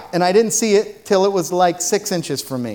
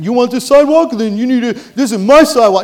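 higher, louder mock tough-guy snake; mock snake challenge; 6 semitones higher, 8 dB louder than usual. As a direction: pretend the snake is picking a fight.

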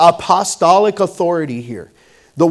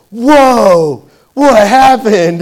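louder, shocked, slow exclamation; surprised friend; 6 semitones higher, 11 dB louder, much slower than usual. Retold or imitated. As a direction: gasp it out at the broken tooth. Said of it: "he goes".

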